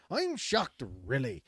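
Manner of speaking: hamming up an Irish quote; mock Irish accent. Shown as a demonstration. Reading a fisherman's quote in mock Irish accent. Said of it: "I wish I could do".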